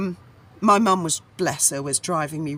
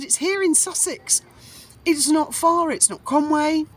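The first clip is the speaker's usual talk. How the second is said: higher, eager and breathless with joy; excited to mum; 10 semitones higher than usual. Retold or imitated. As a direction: tell mum the good news, thrilled. Retold excitement to her mum.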